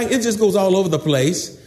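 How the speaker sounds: normal talking voice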